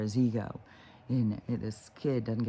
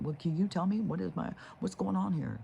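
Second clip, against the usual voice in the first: high, put-on pleading question; mock-helpless, raised pitch; helpless patient; 7 semitones higher than usual. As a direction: mimic a clueless patient asking the doctor. Voicing a patient asking a doctor.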